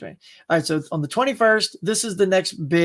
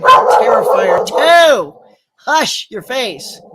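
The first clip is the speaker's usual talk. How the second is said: mock spooky voice; 4 semitones higher, 8 dB louder, much slower than usual. Talking back to barking dog.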